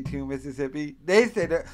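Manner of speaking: ordinary delivery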